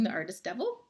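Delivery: ordinary delivery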